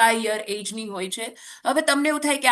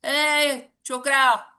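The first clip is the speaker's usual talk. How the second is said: slow, drawn-out mock shout to the kids; mock call out; 5 semitones higher, 3 dB louder, much slower than usual. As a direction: call the street boys home, half acting. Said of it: "કાંતિ આમ એક બૂમ પાડે ને". (છોકરાઓને ધીમી, ખેંચેલી બૂમ)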